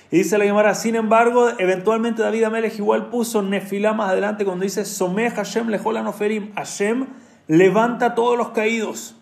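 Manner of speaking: rising preacher's fervor; preaching at full force. Acted. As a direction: lift the room toward the promise.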